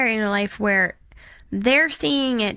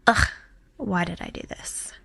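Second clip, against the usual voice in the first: hushed, weary groaning complaint; imagine being fed up and whine under your breath; mock whining; 5 dB quieter than usual. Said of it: "Jesus going the entire time whining".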